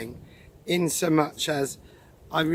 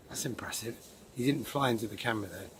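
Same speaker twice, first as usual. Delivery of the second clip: whisper; 3 semitones lower, 9 dB quieter, faster than usual. Real, not acted. Spotting a dragonfly.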